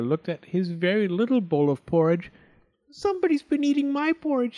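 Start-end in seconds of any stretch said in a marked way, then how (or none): 2.9-4.6 fast, very high cub squeak; crying Baby Bear. A narrator's reading. Baby Bear finds his porridge gone, squeaky cry. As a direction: squeak it out quickly in mock upset. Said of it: "he cried".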